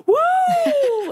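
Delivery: sudden high, loud whoop of joy; crowd cheer. Retold or imitated. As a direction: whoop like the whole crowd just won. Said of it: "let out this".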